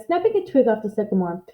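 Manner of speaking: usual speaking voice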